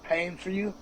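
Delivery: ordinary delivery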